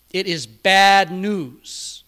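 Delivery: loud and slow, every word weighed; a loud, deliberate preacher's warning; slow loud declaration. Acted. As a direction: hammer it out slowly for the pews.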